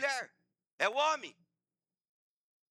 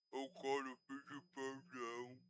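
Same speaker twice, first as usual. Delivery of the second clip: low, slow, soft mumble imitating a stroke patient; barely manage to form the words; slurred stroke speech; 5 semitones lower, 8 dB quieter, slower than usual. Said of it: "Fala direito".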